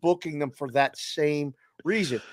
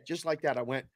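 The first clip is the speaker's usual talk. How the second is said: ordinary talk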